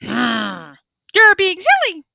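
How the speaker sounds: high squawky parrot copy; a parrot's high, squawking echo; parrot growl. A narrator's reading. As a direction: parrot mimicking the panda's growl, pitched high. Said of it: "the parrot growled".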